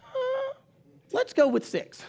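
long high squeaky hesitation, then the answer; sheepish hedging. Retold or imitated. Pastor padding a small youth group number, sheepish and high. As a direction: stall in a high voice, then offer a guess.

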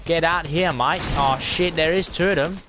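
put-on Australian accent. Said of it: "doing this in an Australian accent".

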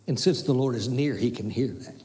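ordinary talk, nothing marked

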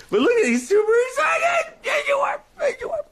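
very high squealing at the dog; shrieky delight over a pet; excited pet talk. Real, not acted.

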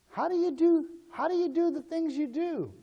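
very high-pitched asking; acted questioner. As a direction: pipe up high as an onlooker. Voices someone questioning the believer.

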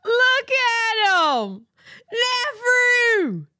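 high, drawn-out wail of delight; overjoyed high cry; gushing excitement. Real, not acted. Gushing over the baby photo.

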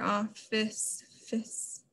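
office then a whispered fis; syllable split. Shown as a demonstration. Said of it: "this break is right here".

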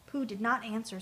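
ordinary delivery

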